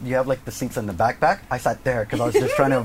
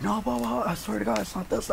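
high, softer pleading denial; imitating a kid's defensive pleading; arguing back; 8 semitones higher, 5 dB quieter than usual. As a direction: protest your innocence in a raised pitch. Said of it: "I'm trying to argue back".

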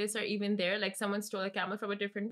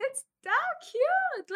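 gushing; 4 dB louder, much slower than usual. Real, not acted. Gushing over camel story.

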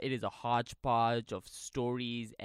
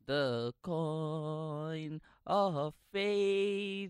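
ominous drawl; 3 semitones higher, much slower than usual. Acted. Drawn out ominous echo.

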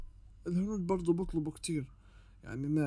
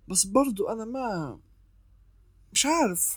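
ordinary talk, nothing marked

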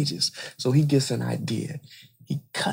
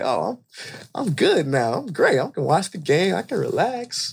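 high, singsong self-satisfied relief; relieved dad; 6 semitones higher than usual. Acted. Dad free to watch the game, gleefully relieved. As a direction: act out a father delighted he's off the hook.

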